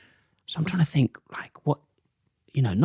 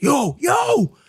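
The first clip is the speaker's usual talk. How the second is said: retold shout; 13 semitones higher than usual. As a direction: yell out to get attention. Stranger shouting from behind.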